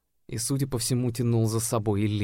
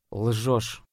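level, calm, curt accusation; calm cut-off. A narrator's reading. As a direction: cut her off coolly. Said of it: "спокойно отрезал я".

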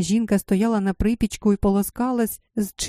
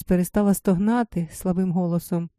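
usual speaking voice

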